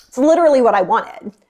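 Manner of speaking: ordinary delivery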